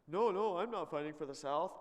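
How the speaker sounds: high, loud mock protest; hammed-up denial. Traveler at the Union crossing gate, protesting innocence. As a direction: deny it too quickly and loudly.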